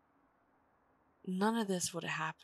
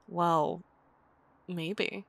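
usual speaking voice

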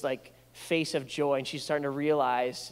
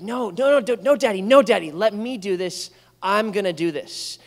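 put-on louder, higher stubborn child voice; insistent toddler; 5 semitones higher, 10 dB louder than usual. Father imitating his daughter refusing help, higher and louder. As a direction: insist like a toddler who wants to do it herself.

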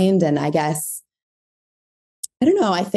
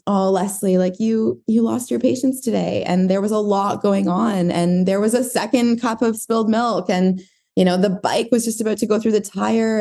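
higher, tender and understanding; mother voice; 5 semitones higher than usual. Acted. Mother reassures herself after losing patience, tender voice. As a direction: comfort yourself as you would your own child. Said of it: "like, the mother voice".

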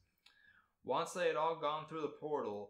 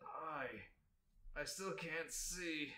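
halting, softly muttered complaint; 3 semitones higher, 7 dB quieter, slower than usual. A narrator's reading. Sasuke, still blind, to his friends, muttered. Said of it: "Sasuke muttered".

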